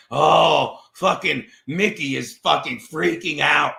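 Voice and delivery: low, loud, drawn-out mock groan; slow, heavy put-on voice; imitated gloating listener. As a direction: drop the pitch and stretch it out in mockery. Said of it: "he's not sitting there like".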